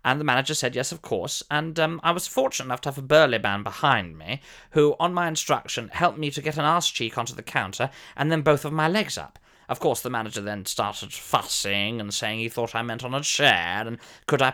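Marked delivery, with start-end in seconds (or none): none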